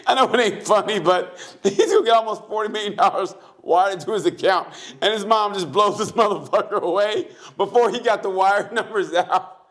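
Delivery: high, amused recap through laughter; real laughing. Real, not acted. Recapping mom blowing him away, laughing. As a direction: tell it while struggling not to crack up. Cracking up while recapping the shooting.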